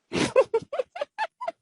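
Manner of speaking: squealing high laugh. Real, not acted. Laughs at aphrodisiac joke.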